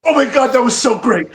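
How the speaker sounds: high, loud, breathless fan gushing; gushing fanboy. Retold or imitated. Kid fan quizzing his wrestling heroes at dinner, loud high gushing. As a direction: gush like a starstruck kid meeting heroes. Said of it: "one thousand percent fanboy mode".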